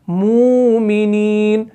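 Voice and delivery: very slow मुमिनीन; slow recitation. Shown as a demonstration. Teacher gives the underlying word slowly. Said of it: "क्या है असल में?".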